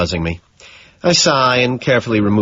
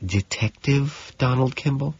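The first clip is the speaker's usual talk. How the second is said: softer, slow, questioning; lowered voice; 4 semitones higher, 7 dB quieter than usual. A narrator's reading. Jean lowering her voice to tell Patrick about the detective. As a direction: drop the voice as if asking. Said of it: "lowers her voice".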